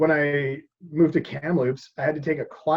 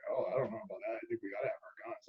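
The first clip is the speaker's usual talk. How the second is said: a quieter, lightly voiced doubtful American; mock American gun lover; 6 semitones lower, 15 dB quieter, faster than usual. Other couple on vacation, dismissive and quieter. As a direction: brush off the idea of giving up guns. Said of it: "The other couple was like".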